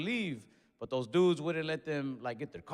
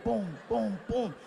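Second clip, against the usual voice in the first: high punch sounds; 6 semitones higher than usual. Retold or imitated. Imitating his punches.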